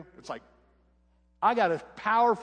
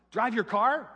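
higher and louder disbelieving question; can't believe what she's hearing; amazed; 7 semitones higher, 6 dB louder, slower than usual. Retold or imitated. Teen girl amazed her dad lets her drive, surprised.

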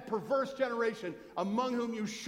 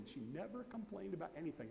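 hushed eulogy; 3 semitones lower, 12 dB quieter than usual. Retold or imitated. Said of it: "they say".

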